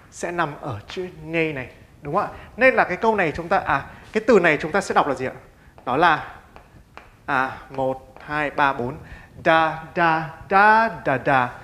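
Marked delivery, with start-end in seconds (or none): 5.8-11.5 counting syllables then tapping "da, da, da" with beats; stress beat count. Shown as a demonstration. Teacher tapping out syllable rhythm to show the stress. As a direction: beat out the syllables "da da da" with a push on one. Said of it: "Chúng ta sẽ nhấn vào cái tiếng này".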